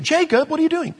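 can't believe what he's doing; high incredulous call. Retold or imitated. Preacher's own inner exclamation.

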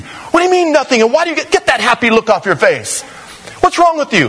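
can't believe what he hears; old tax friend voiced in disbelief; baffled old friend.

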